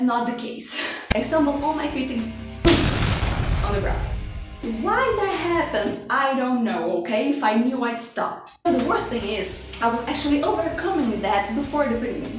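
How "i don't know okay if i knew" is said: ordinary talk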